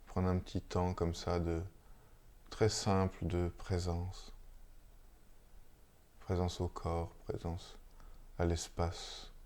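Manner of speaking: unhurried, hushed meditation guidance with long silences; guided meditation. Real, not acted. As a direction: lead listeners gently into stillness, phrase by phrase. Guide une méditation après la lecture. (méditation guidée, posée, entre de longs silences)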